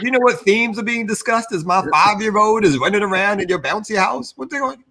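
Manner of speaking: high-pitched incredulous questioning; retold indignation. Parent complaining about themes around a five-year-old, indignant.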